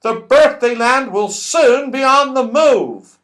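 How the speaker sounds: high, loud, hurried warning; urgent Moonface voice. Acted. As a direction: warn them to hurry. Moonface urging everyone away.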